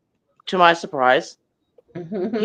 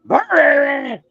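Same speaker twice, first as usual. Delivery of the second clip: high rolled growl "Brrr" in a dog voice; imitated dog growl; 11 semitones higher, 4 dB louder than usual. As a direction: mimic a dog warning someone off.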